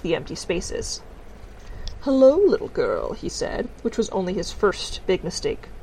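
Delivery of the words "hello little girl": slow, higher, louder greeting talking down; patronizing. A narrator's reading. Teacher greeting young Tiffany, patronizing. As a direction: draw it out as to a small child.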